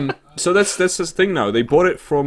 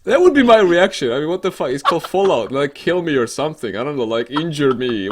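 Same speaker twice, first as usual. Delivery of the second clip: mock outrage; 6 semitones higher, 8 dB louder than usual. Real, not acted. Mock outrage over the weak beer.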